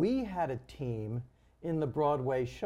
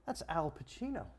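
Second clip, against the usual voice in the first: softer, starstruck aside; hushed awe; 7 dB quieter than usual. Retold or imitated. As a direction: drop the volume as if spotting a star. Retells awed spotting of star.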